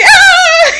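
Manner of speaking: high, loud mock scream of a burning bear; laughing fake scream of pain. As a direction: shriek it out, half laughing. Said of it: "Smokey the Bear is screaming".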